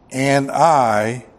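loud, slow, half-sung pulpit intoning; intoned scripture. Acted. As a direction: stretch the words like a chant. Preacher reads scripture, half-sung.

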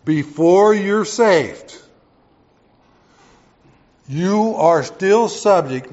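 slow, loud preaching build. Real, not acted. Pastor to congregation, slow and loud.

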